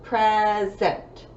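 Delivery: "Present" in two long, separate beats; syllable by syllable. Shown as a demonstration. Teacher counting syllables for pupils, "Present" broken in two. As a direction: clap out "Present" slowly by syllable. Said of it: "Two syllables".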